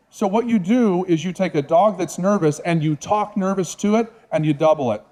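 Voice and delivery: usual speaking voice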